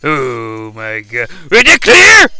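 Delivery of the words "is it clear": high, loud squeal; excited. Acted. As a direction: squeal at the screen. Excited at the screen.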